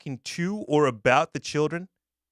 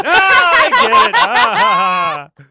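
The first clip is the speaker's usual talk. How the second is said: mock laugh; 12 semitones higher, 8 dB louder, slower than usual.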